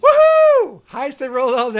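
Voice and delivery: loud, very high triumphant whoop; real cheer. Real, not acted. The player cheers his best roll, loud and very high. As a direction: celebrate the big roll.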